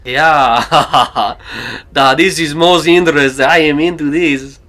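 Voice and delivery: put-on loud, booming Russian accent with a laugh; be sold on it in a big Russian accent; [name]'s Russian accent. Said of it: "I mean, a Russian accent".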